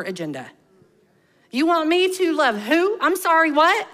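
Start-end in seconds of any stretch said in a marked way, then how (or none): 1.5-3.9 mock disbelief, pitched up; higher, disbelieving "what?"; incredulous. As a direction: ask as if you misheard something outrageous. Mock reply to God's command.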